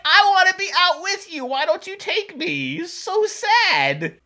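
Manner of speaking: pitiful pet voice. Pretend. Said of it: "He's like".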